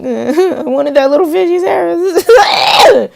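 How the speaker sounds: put-on high-pitched whiny kid sister, rising to a squeal; mock a little sister wailing for a fish; exaggerated crying-child squeal. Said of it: "my sister's all like".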